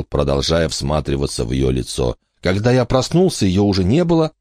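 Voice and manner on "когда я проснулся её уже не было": put-on higher, lighter boy voice; a puzzled boy reporting; boy's voice. Michael answers about Emma.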